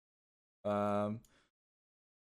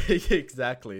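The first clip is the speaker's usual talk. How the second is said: laughing; 4 semitones higher, 9 dB louder, slower than usual. Real, not acted. Laughing agreement with chat.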